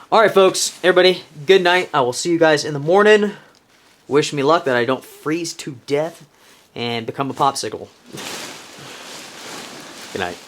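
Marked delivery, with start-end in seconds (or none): none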